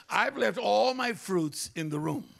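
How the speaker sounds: preacher ramping from loud to shouting; building sermon list. Acted.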